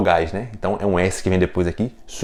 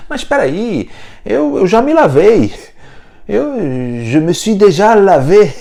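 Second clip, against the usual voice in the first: higher and louder, mock protest; protesting French visitor; 9 semitones higher, 5 dB louder than usual. Mock French visitor protests he already bathed, raised. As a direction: object like an offended visitor.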